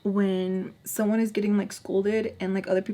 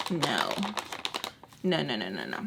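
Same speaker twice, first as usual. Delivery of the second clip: much lower, dropped-down no; low hopeless no; 8 semitones lower, 6 dB quieter than usual. Retold or imitated. Telling how the carpet cleanup failed, low refusing no. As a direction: drop your pitch right down on each no. Dismissing the attempt to fix carpet.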